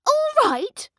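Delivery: very high, loud outraged echo; indignant cry. A narrator's reading. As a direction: fling the words back in disbelief. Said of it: "cried Cindy".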